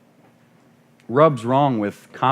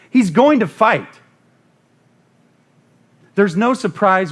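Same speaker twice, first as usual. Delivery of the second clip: loud, high, building sermon cry; preaching at full force; 8 semitones higher, 6 dB louder than usual. Acted. Preacher to congregation, rising fervent shout. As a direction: preach it out against the devil.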